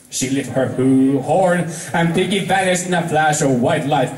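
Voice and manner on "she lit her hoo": ordinary delivery, nothing marked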